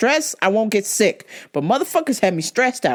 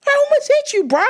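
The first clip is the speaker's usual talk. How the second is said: very high, louder shout; shrill yell; 4 dB louder than usual. Retold or imitated. Driver yelling at Jaguar driver, shrill yell. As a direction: yell it at the other car. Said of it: "I'm like".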